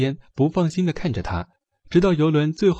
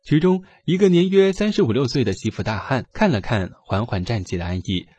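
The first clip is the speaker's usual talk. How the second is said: usual speaking voice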